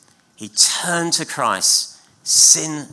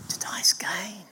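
hushed whisper; 3 semitones higher, 8 dB quieter than usual. Real, not acted. Preacher repeating Paul's words to the congregation, whispering. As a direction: whisper the verse softly.

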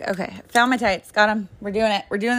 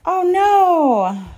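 dismayed oh no; 10 semitones higher, 6 dB louder than usual. Real, not acted. Spots her deflated Squish Squish.

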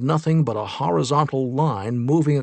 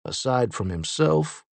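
usual speaking voice

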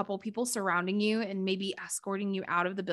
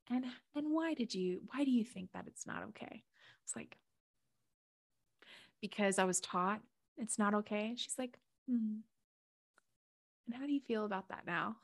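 much softer, gentle questioning; soft guiding voice; 8 dB quieter than usual. Retold or imitated. Mama Gaia gently questioning her, soft and calm. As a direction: ask it softly, like a patient guide.

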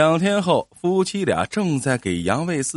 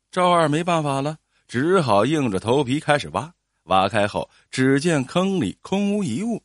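ordinary delivery

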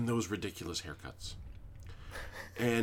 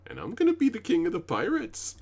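high, loud proclamation of his name and dream; proud boast; 8 semitones higher, 8 dB louder than usual. Retold or imitated. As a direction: announce it like a kid who truly believes it. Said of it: "And he goes".

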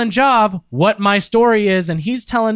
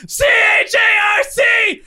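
high, slow shouted spelling; shouting letters; 13 semitones higher, slower than usual. Real, not acted. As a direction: yell the letters out slowly. Spelling while cheeks pinched.

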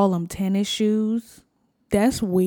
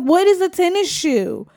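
higher puzzled question; confused question; 9 semitones higher than usual. Retold or imitated. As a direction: ask it baffled and higher. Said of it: "I was like".

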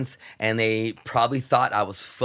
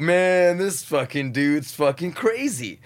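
imitating [name] guys; 6 semitones higher, 3 dB louder, slower than usual.

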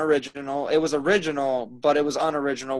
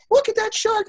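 mocking high voice; 4 dB louder than usual. Mocking a dull movie scene.

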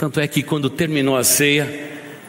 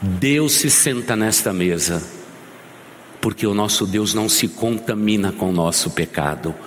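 usual speaking voice

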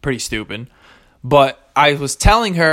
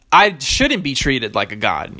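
ordinary talk

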